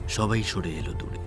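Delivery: ordinary talk, nothing marked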